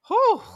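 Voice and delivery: high loud whew; fanning herself, loud high whew; hot whew. Real, not acted. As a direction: blow out a flustered whew. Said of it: "she's fanning herself".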